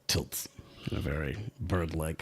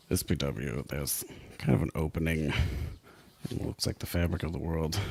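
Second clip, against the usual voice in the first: ordinary talk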